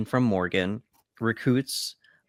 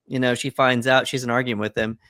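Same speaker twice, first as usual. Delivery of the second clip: ordinary delivery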